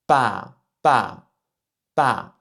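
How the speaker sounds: pa firm, unaspirated; Mandarin b. Shown as a demonstration. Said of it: "entre le B et le P". (pa répété, fort, descendant)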